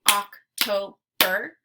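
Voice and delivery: October clapped out as "Oc, to, ber"; October in three separate beats; broken into syllables. Shown as a demonstration. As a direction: say October slowly, one syllable at a time. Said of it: "we're clapping out the syllables".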